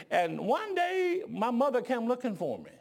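normal talking voice

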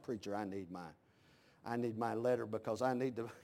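low, soft member's plea; mock meek request. Mimics member asking for transfer letter.

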